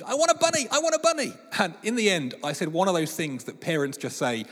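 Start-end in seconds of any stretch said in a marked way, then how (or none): 0.0-1.3 put-on much higher, louder childish chant; nagging little girl. Father imitating his daughter Mia begging, high childish chant. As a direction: whine it like a relentless five-year-old. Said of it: "But she was relentless".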